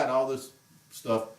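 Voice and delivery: ordinary talk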